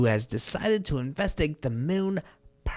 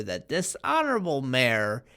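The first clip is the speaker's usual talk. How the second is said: normal talking voice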